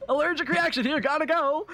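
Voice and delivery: high, urgent mock yell; high-pitched hammed-up call to clear the way. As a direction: yell it out as if pushing through a crowd. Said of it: "just yelling your way through".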